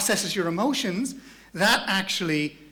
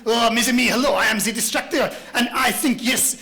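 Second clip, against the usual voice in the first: put-on higher, hammy French accent; distractor's French accent; 4 semitones higher, 4 dB louder than usual. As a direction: greet them with flamboyant mock-French flair. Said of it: "Let's imagine this character called a distractor".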